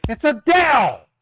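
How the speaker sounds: sharp angry shout; loud sharp voice. Real, not acted. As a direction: yell it, fed up. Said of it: "Why are you yelling me".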